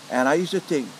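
ordinary delivery

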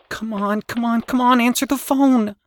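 high, pressing repeat; urgent. Acted. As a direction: push it urgently. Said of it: "Danny said urgently".

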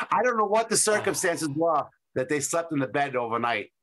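ordinary delivery

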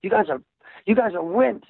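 high, fast mocking Australian boss; mock Australian accent. Dispatcher taunting the band guys, mock Australian accent. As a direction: taunt them in a put-on Australian voice. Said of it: "And he'd go".

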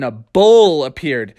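plain reading voice

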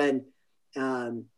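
ordinary delivery

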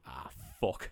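whispered under the breath; whispered curse. Retold or imitated. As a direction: hiss it in dismay.

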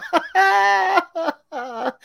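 loud high laughing burst. Real, not acted. Laughing at announcement.

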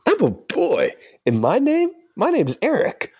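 put-on boyish anime voice. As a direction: declare it proudly as the young hero. Acted anime scene, character A.

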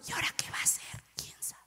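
breathy unvoiced whisper of worried disciples. Disciples whispering to each other, worried whisper. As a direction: lean in and whisper the doubt. Disciples muttering among themselves.

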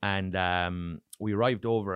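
normal talking voice

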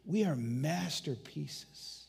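usual speaking voice

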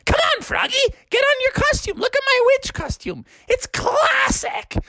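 very high, excited witch voice; witchy mom. Acted. Mom shows Froggy her witch costume, high and witchy. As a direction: squeal it, stretching the last word. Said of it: "she's like".

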